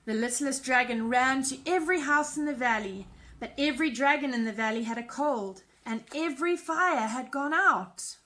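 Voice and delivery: put-on lively storybook narration for kids; animated picture-book reading voice; reading to children. A narrator's reading. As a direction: read it like bedtime story time. Reads a children's story aloud.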